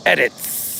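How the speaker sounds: Edits with a long hissing s at the end; drawn-out s like a snake. Shown as a demonstration. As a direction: stretch the final s of Edits like a snake. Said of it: "would you say it like snake".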